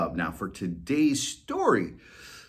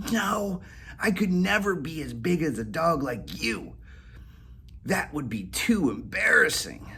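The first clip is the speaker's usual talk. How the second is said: higher, lightly voiced cat, amused; giggly cat voice; 7 semitones higher than usual. Whiskers giggling at Molly, higher cat voice. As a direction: tease the dog with a giggle. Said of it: "Whiskers giggled".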